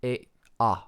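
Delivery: A said the French way, open ah; A named as in French, ah; A as a French letter, ah. Shown as a demonstration. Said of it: "in French, A".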